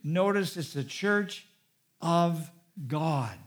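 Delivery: slow, pointed "of God", each word set apart; emphatic. Real, not acted. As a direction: punch "church", then pause before "of God". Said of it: "heard it in my voice".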